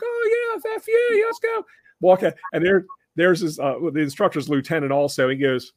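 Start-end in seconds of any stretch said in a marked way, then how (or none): 0.0-1.7 very high mock drunk slur; drunk kid voice. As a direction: play the drunk probie cheerfully swearing as he goes outside. Drunk kid agrees to go outside.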